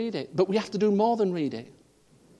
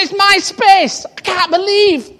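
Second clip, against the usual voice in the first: very loud, much higher and slow, stretched outrage; furious yell; 14 dB louder, slower than usual. Retold or imitated. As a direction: bellow it like you've lost it. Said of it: "I said".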